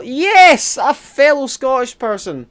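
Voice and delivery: loud, high burst of delight; excited. Real, not acted. Streamer finds a fellow Scot in chat, excited.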